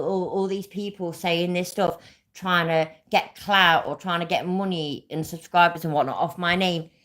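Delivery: ordinary talk, nothing marked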